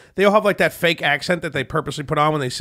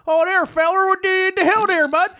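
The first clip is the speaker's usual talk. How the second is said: mock Southern accent; 13 semitones higher than usual. Imitating the band from down south, mock Southern accent. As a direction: do an exaggerated, high Southern accent. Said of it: "busted each other's chops for our accents".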